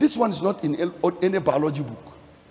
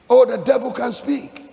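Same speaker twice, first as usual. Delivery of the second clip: raised pitch, emphatic exclamation; exclaimed warning; 7 semitones higher, 4 dB louder than usual. Real, not acted. Preacher warning believers about the devil, high exclamation.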